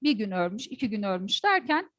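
usual speaking voice

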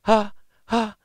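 weak, airy sample notes; breathy voice. Shown as a demonstration. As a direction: let lots of air through each ha. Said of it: "ブレス的な声ってどういう声かと".

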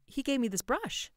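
high, anxious explanation; trying to explain. A narrator's reading. Wife explaining the visitor to her drunk husband, placating. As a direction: explain it hurriedly upward in pitch, placating. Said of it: "Mary tried to explain".

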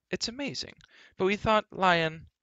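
very high-pitched, marvelling prince; amazed prince. A narrator's reading. Prince blurts out before being warned.

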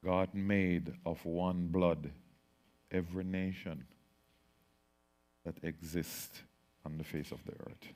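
hushed, reverent scripture reading; solemn recitation. Acted. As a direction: intone the verse slowly, almost to yourself.

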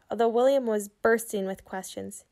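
ordinary delivery, nothing marked

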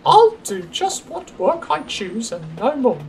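high-pitched, self-satisfied voice; smug lady diesel. Acted. Daisy privately gloats to herself, smug.

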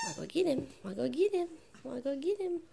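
high teasing game voice; playful taunt. Real, not acted. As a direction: egg the puppy on.

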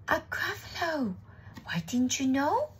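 high, lilting reply; mock-surprised mouse. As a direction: act as if everyone should know. Mouse answers the owl's question.

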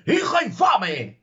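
hammed-up furious father's roar; roaring count. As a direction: roar it at the disgraced daughter. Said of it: "ruge el conde".

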